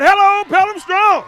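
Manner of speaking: even bigger rally call, high and loud. Acted. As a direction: give the crowd a bigger shout. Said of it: "we can do better than that".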